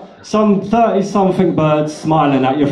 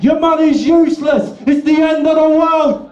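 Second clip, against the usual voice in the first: much higher shrieking panic, hammed up; mock panicked scream; 14 semitones higher than usual. Comic telling bankers fleeing, shrieking hammed-up panic. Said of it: "screaming like".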